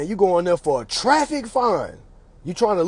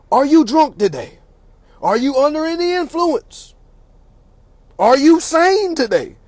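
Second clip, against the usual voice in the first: high-pitched mock judge questioning; mocking, deliberate courtroom interrogation; judge looking down; 8 semitones higher, 3 dB louder than usual. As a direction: condescend from the bench in measured questions. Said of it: "sitting on them podiums looking down at us".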